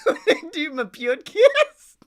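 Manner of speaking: high, loud laughter through the words; cracking up. Real, not acted. Speaker mocks his own slipping accent, really cracking up. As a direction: lose the accent in helpless laughter. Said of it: "what this voice is becoming".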